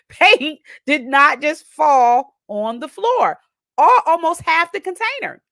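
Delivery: high, loud, slow cry of disbelief; loud, high and slow stunned outcry; panicked disbelief. Retold or imitated. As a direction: plead that it isn't real. Panicked inner voice at spilled paint.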